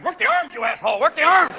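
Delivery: hammed-up irritated yell; Ashcroft. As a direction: snap urgent coaching from behind. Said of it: "Ashcroft in the back going".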